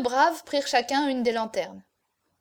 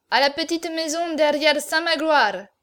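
raised, slightly higher ordering voice; commanding order; 3 semitones higher, 5 dB louder than usual. A narrator's reading. Cocardas orders the chaise off to the little house, commanding. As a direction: call out the order firmly. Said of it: "ordonna Cocardas".

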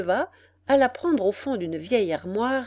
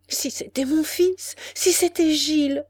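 higher, eager burst of hope; hopeful exclamation; 7 semitones higher than usual. A narrator's reading. Mother at the door hoping for her son, eager. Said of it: "s'écria-t-elle".

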